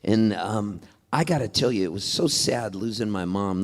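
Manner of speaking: ordinary delivery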